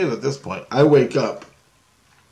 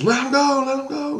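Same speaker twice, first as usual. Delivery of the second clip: restrained, pitched-up imitation of screaming; retold scream. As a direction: cry it out as if charging in. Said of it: "he's screaming as he's coming".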